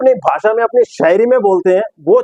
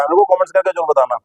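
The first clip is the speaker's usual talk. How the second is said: ordinary talk, nothing marked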